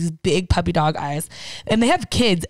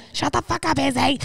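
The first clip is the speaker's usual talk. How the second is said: mock irritation; 6 semitones higher than usual. Imitating a man telling off Izzy, high mock irritation. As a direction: scold the dog in a strained high imitation. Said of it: "He's like".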